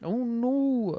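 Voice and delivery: high put-on wail; hammed-up high "poor me" voice; mock dismay. Joins the mock lament as [name].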